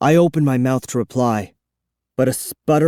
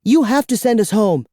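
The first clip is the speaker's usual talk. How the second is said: put-on demanding girl; 9 semitones higher, 3 dB louder than usual. A narrator's reading. Kara demands.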